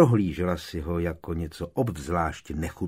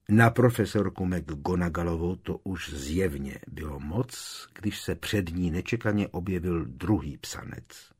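ordinary delivery, nothing marked

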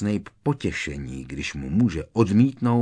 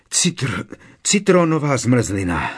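out of breath; 4 semitones higher, 7 dB louder than usual. Acted. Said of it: "zasupěl bez dechu".

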